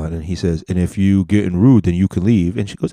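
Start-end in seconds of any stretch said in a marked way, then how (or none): none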